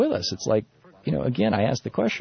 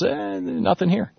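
mock shrug; 10 semitones higher, slower than usual. Mocking the Duelfer report's conclusion.

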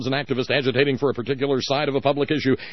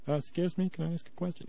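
much softer, timid ask; mock a shy polite request; meek mock question; 5 semitones higher, 10 dB quieter than usual. Host mimicking a reporter politely asking to speak, softly.

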